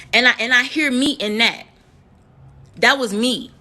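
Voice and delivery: normal talking voice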